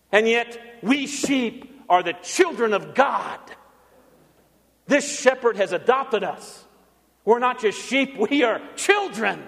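ordinary talk, nothing marked

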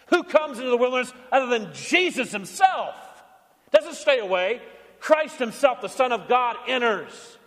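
high, ringing sermon build; rousing preaching. Real, not acted. Pastor proclaiming Jesus entering the wilderness, rousing preaching. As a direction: proclaim each phrase with rising conviction.

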